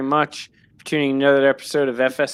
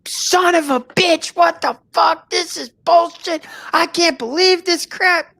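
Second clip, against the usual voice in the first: retold angry outburst; 14 semitones higher, slower than usual. Man at airport rages over lost video, high slow rant. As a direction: fume at the lost recording, pitched high. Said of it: "I start flipping out".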